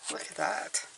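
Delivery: a breathy near-whisper over the card; hushed aside. Real, not acted. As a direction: murmur it to yourself, barely voiced. Reader murmurs over a card.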